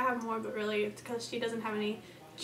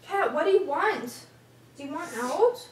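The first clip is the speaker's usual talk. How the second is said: calling out; 4 semitones higher, 6 dB louder than usual. Real, not acted. Calling to someone off camera.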